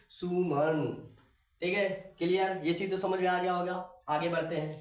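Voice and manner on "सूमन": सुमन with the u held long; long heavy u. Shown as a demonstration. Teacher reviews the big matra word, long u. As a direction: stretch the u in सुमन. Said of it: "भारी आवाज़ में बोलेंगे".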